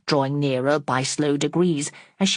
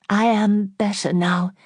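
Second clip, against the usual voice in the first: usual speaking voice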